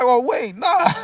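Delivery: high, laughing take-back; cracking up as he backs off; laughing doubt. Retold or imitated. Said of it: "I'm like".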